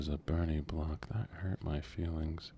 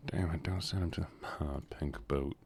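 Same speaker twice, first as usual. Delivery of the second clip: usual speaking voice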